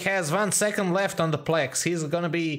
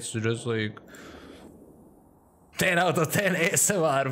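normal talking voice

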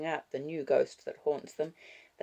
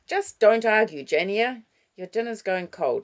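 loud, raised, firm reprimand; exasperated scolding; 7 semitones higher, 10 dB louder than usual. Acted. Grandmother ordering the child to dinner, exasperated scolding. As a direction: cut the child's argument short.

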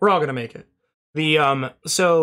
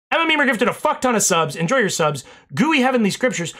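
normal talking voice

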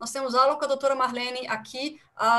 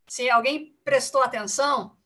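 ordinary talk, nothing marked

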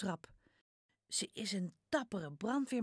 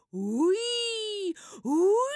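put-on high loud child's siren, rising at the end; loud siren call; 13 semitones higher, 11 dB louder than usual. Jip playing fire engine, calling a high loud siren. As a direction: shout hoei like a toy fire engine. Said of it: "Jip roept heel hard".

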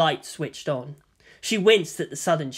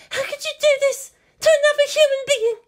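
very high, distressed woman's voice; anguished protest; 4 dB louder than usual. Acted. Captive woman confronting her kidnapper, anguished. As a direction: protest in a high, pained cry.

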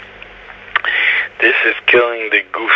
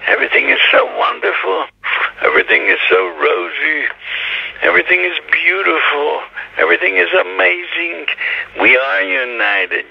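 mock rosy bliss, pitched up; everything-is-wonderful tone; 5 semitones higher than usual. Imitating the head of the place declaring everything beautiful, in mock bliss. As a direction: parody a starry-eyed believer gushing in a higher voice. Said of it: "the tone of voice that says".

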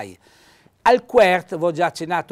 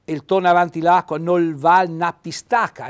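Trentino dialect; 3 semitones higher, 8 dB louder than usual. Shown as a demonstration. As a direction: recite the proverb in Trentino dialect. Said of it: "ecco questa pistaca".

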